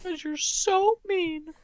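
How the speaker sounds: slow, high squeaky whimper; mock tearful. Offended character accuses the hosts, mock tearful. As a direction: squeak out a hammed-up hurt complaint. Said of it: "I don't know why you're crying".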